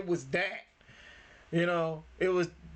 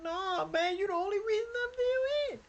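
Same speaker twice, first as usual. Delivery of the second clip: lightly acted brother, high and earnest; pleading brother. Brother telling his sibling he's the only reason, high earnest plea. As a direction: plead like the little brother who needs him. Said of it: "but he still ain't like".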